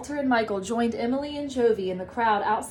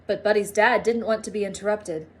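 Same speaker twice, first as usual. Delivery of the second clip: plain reading voice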